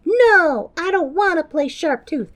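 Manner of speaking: put-on high snooty little girl voice; bratty kid voice. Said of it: "And Sarah is like".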